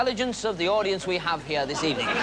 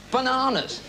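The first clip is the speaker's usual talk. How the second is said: Bananas! laut und hoch herausgerufen; exasperated shout; 8 semitones higher, 4 dB louder than usual. Acted. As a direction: ruf es genervt heraus. Exasperated repeat of punchline.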